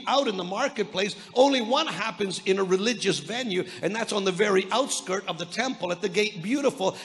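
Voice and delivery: ordinary talk, nothing marked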